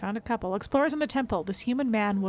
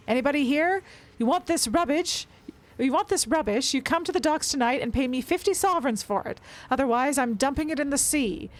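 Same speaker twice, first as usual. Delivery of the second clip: shouting; 6 semitones higher than usual. Acted. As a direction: shout it up into the dark. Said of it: "and shouted".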